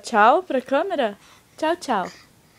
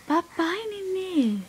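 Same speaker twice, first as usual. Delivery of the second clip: soft, higher, lilting coaxing; 8 semitones higher, 6 dB quieter, slower than usual. Real, not acted. Parent coaxes baby to speak.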